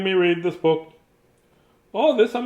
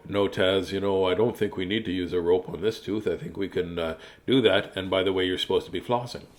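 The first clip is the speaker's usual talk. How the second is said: lower, softer calm dentist; 8 semitones lower, 7 dB quieter than usual. Retold or imitated. Dentist Robert Grant telling Taz to floss, low and soft. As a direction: settle into a low gentle dentist tone. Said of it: "He would go".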